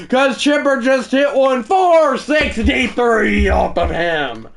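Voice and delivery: hyped sportscaster shout; shouted play-by-play. Acted. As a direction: yell the homer like a TV broadcaster. Play-by-play call of a homer.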